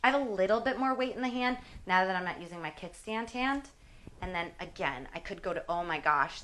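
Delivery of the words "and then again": ordinary delivery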